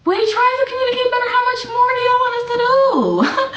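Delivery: very high-pitched, strained exasperation; exasperated plea. Real, not acted. Streamer defending efforts to communicate, exasperated. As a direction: push it way up high, pleading.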